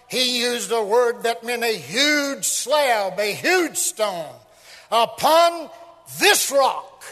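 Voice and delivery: loud, emphatic exposition; full-force preaching. Real, not acted. Preacher expounding the rock to his congregation, full-force preaching. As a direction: pound out the key words from the pulpit.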